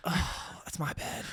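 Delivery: unvoiced, whispered groan; hushed dismay. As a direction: whisper it, as if near sleeping babies. Finding babies asleep in bed.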